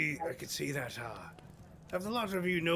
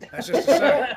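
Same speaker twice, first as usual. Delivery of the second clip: laughing through it; 8 semitones higher, 14 dB louder than usual. Real, not acted. Breaks into laughter mid-line.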